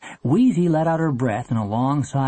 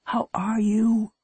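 high, slow, whispered question; hushed mother's greeting; tender; 8 semitones higher, slower than usual. A narrator's reading. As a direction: ask slowly, almost in a whisper. Long-absent mother greets her son.

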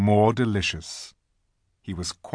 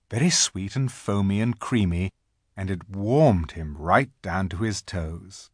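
ordinary delivery

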